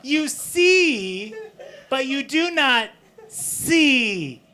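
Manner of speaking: overwrought shouted slam poem, slow; slam poetry. Acted. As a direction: ham up a tortured slam poet. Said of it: "I wanted to perform a slam poem for you".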